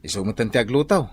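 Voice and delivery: usual speaking voice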